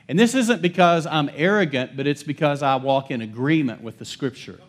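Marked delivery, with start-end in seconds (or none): none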